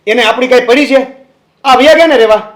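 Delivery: loud, high-pitched indignation; angry outburst. Acted. To mother about the father who left, angry.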